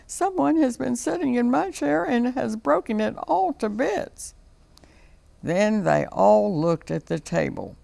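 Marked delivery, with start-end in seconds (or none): 0.1-4.3 much higher, small cub voice; baby bear's wee little voice. A narrator's reading. Baby bear finds his broken chair, wee high voice. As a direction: squeak it like a tiny upset bear. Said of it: "in his wee little voice".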